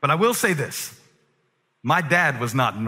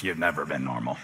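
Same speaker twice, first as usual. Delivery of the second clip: very low, deep bass voice; deep, soft bass rumble; Josh Blackson voice; 13 semitones lower, 7 dB quieter than usual. Shown as a demonstration. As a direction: drop to the bottom of the range. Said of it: "say it in the Josh Blackson voice".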